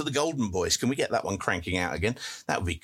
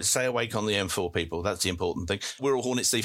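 ordinary delivery

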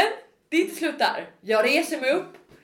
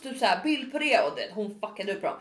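usual speaking voice

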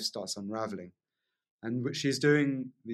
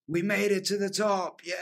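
high, excited shout of triumph; joyful shout; 8 semitones higher than usual. Retold or imitated. Wim Hof cheering at the top of Everest, high joyful shout. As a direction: cheer it out like reaching the summit. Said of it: "starts laughing his head off, going".

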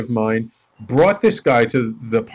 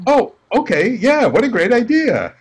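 high-pitched, sudden mock enthusiasm; retold eager realization; 10 semitones higher, 3 dB louder than usual. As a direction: act out catching on, voice jumping up. Retold sudden agreement with wife's idea.

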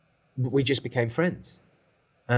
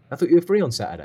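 louder, mock-angry reproach; furious telling-off; 3 semitones higher, 7 dB louder, much faster than usual. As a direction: imitate an irate mentor challenging an excuse. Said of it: "Glenn was furious".

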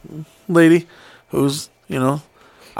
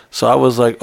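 usual speaking voice

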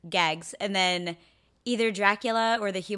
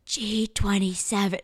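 loud unvoiced stage whisper. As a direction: whisper it loud enough for the room. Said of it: "that loud whisper is funny".

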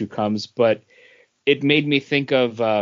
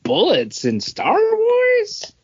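high, slow, baffled question; a jokey squeak of incredulity at the idea; incredulous; slower than usual. Retold or imitated. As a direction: drag it out in disbelief. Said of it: "But I was like".

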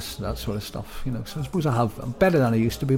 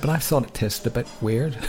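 ordinary delivery

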